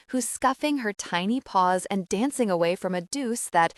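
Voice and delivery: ordinary delivery, nothing marked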